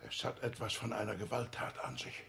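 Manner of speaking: ordinary delivery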